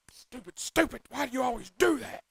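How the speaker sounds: high mock self-scolding. Interviewer mocking his own blunder, high pitched self-scolding. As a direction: scold yourself like Chris Farley's flustered guy. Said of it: "Like Chris Farley's doing the interview".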